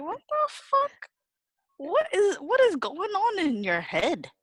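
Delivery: high, slow baffled disbelief; incredulous outburst. Retold or imitated. Daughter baffled by mom's toast comments, high incredulous cry. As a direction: ask what is going on in her head.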